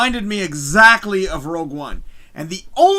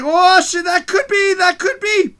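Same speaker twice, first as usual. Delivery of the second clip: high, loud excited outburst; losing his mind; 10 semitones higher, 7 dB louder, slower than usual. Retold or imitated. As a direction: blurt it high and loud, hopes racing. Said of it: "So I'm losing my mind".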